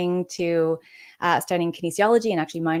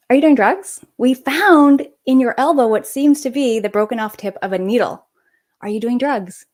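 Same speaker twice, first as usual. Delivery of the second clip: louder, pressing doctor demanding an answer; insistent question; 5 semitones higher, 7 dB louder than usual. Retold or imitated. Doctor pressing the teenage patient, louder and insistent. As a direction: repeat it harder, like a doctor who won't let it go.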